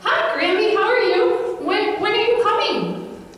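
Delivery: put-on high, eager child voice; little boy voice. Young son greeting Grammy on the phone, high child voice. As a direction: chirp it like a small boy on the phone. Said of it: "and he says to her".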